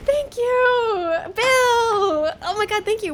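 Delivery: high, loud thrilled thank-you shout; excited shouting. Real, not acted. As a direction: scream thanks with joy. Huge donation thanks shout.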